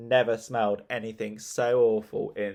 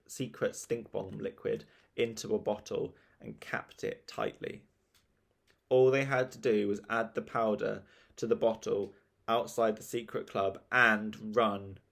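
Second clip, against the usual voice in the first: plain reading voice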